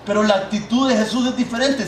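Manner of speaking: normal talking voice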